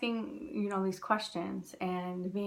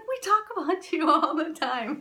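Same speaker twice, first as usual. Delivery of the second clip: higher, louder, eager and bubbly; excited stranger; 8 semitones higher, 7 dB louder than usual. Retold or imitated. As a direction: tell her eagerly she's famous at home. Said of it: "He's like".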